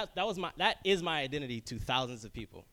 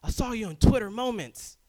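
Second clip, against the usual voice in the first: higher, louder excited coworker voice; imitating coworkers; 8 semitones higher, 10 dB louder, slower than usual. Coworker greets him, mock excited voice. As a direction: playfully mimic a coworker. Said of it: "my coworkers are like".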